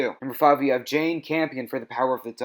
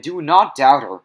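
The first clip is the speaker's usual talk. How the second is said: louder, stern "Do not doubt her"; firm warning; 2 semitones higher, 9 dB louder than usual. Real, not acted. Vlogger warning viewers not to doubt her, loud firm. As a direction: warn them off, hard.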